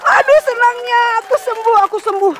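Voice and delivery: high, joyful exclaim; delighted at being healed; rejoicing. Acted. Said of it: "mereka bergirang". (girang dan bersuka cita)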